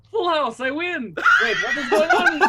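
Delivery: gleeful card player crowing; Alexei voice. Acted. Alexei gloating over winning the card game. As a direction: crow over the winning hand.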